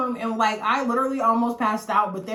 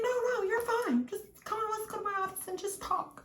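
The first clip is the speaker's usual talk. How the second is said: mock soothing principal; 12 semitones higher, 6 dB quieter than usual. Principal's reassurance retold.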